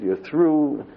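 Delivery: usual speaking voice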